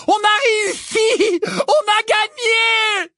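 loud, high, drawn-out victory cries; overjoyed yell. A narrator's reading. Ron brandishing the silver Cup, overjoyed yell. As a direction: scream it slowly, wild with joy. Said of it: "leur cria Ron, fou de joie".

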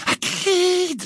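very high, drawn-out cry of alarm; panicked, piercingly high warning; shrill, terrified Hermione. A narrator's reading. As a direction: cry the name in high-pitched terror. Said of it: "d'une voix aiguë et terrifiée".

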